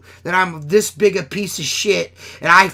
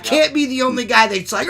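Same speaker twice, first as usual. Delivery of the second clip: usual speaking voice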